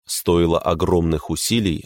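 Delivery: usual speaking voice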